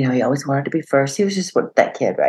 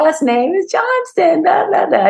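sing-song mockery, much higher; taunting schoolkids. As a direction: imitate kids teasing in a playground chant. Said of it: "the other kids laughing and saying".

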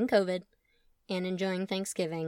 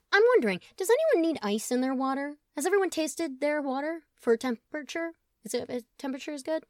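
nervous; 6 semitones higher than usual. Acted. Colin nervously dodging toast.

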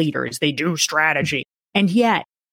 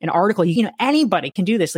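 ordinary delivery

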